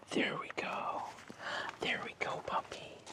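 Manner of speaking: soft whisper to settle the puppy; whispering to a dog. Real, not acted. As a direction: hush it gently, barely voiced. Soothing the lying dog.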